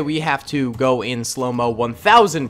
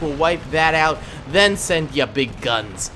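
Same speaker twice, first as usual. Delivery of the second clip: normal talking voice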